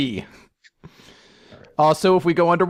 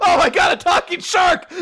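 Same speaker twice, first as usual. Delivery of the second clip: high, loud shout of alarm; mock alarm, shouted high; shocked shout; 14 semitones higher, 3 dB louder than usual. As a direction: yell it at the sudden shark. Kids shout at shark.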